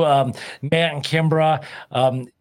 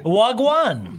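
higher-pitched Jamaican "Wagwan", falling at the end; Jamaican-style hello, pitched up; Jamaican patois greeting; 5 semitones higher than usual. Shown as a demonstration. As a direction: repeat the Jamaican greeting for them. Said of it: "in Jamaican".

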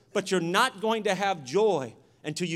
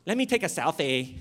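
mocking selfie-taker; 2 semitones lower than usual. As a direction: parody a self-absorbed selfie lover. Mocks the selfie generation.

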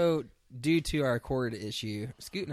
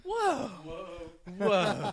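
surprised; 6 semitones higher, 4 dB louder, much slower than usual. Real, not acted. Mock offense at April.

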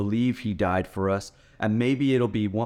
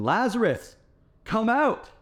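high-pitched call into the tomb; calling out loudly; 12 semitones higher than usual. Retold or imitated. Preacher acting Jesus calling into the tomb: high ringing call. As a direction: call him out of the grave, pitch soaring. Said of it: "he yells in".

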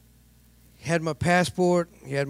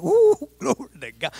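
mock joyful praise; 7 semitones higher, 5 dB louder than usual. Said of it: "think I'm feeling like".

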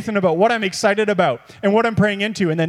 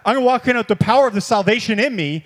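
normal talking voice

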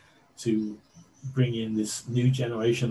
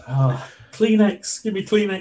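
mock tearful plea; 8 semitones higher, 5 dB louder than usual. Overcome fan begs for tissues in the suit, mock tearful. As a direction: play overwhelmed, pitched way up.

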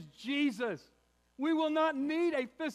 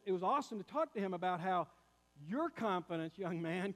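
ordinary talk

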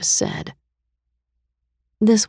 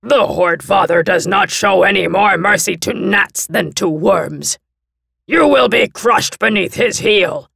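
louder, higher menacing threat; threatening monster envoy; 5 semitones higher, 6 dB louder than usual. A narrator's reading. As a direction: promise to crush them.

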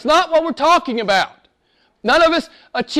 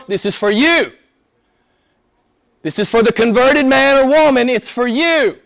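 ordinary delivery

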